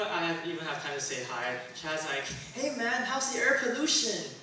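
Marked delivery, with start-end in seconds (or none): 2.5-4.4 higher, dumb-bro mock greeting; mocking Chad. Chad greeting Vincent, hammed-up clueless bro. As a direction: play a clueless bro asking cheerfully. Chad's ignorant greeting quoted.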